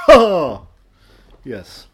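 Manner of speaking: ordinary delivery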